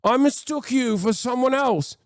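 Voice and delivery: high, fast, cringing apology; hammed-up scared guard backpedaling; flustered guard. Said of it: "the guards will be like".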